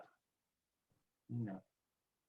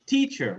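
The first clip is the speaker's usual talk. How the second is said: "teacher" with a high-pitched TEA, falling on -cher; stress on first syllable; 9 semitones higher than usual. Shown as a demonstration. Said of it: "which one is stressed?".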